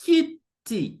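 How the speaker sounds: kiti with high KI, split from TI; stress on first syllable. Shown as a demonstration. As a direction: hit KI high in kiti, pause, drop TI. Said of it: "stress will be on the first syllable".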